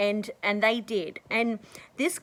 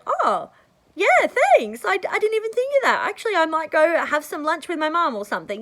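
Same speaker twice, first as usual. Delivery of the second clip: much higher, louder and fast happy thanks; delighted stranger; 7 semitones higher, 6 dB louder than usual. Retold or imitated. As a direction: gush like the thrilled passer-by. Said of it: "And they'll go".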